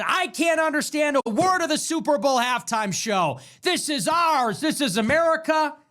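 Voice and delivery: high whiny mock complaint; mocking complainers. Mocking fans who complained about the show, high whiny mock voice. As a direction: sneer it like an offended fan. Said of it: "People said".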